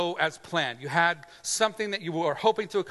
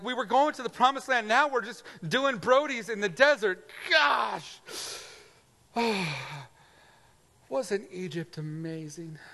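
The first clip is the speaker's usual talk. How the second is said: complaining Israelites; 5 semitones higher than usual. Retold or imitated. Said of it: "They're like".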